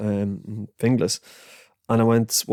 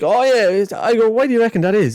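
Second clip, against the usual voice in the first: higher, louder mock-enthusiastic chat; mock eager; 11 semitones higher, 5 dB louder than usual. Narrator eggs the boy on, higher and louder. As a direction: egg the kid on brightly. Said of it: "I was like".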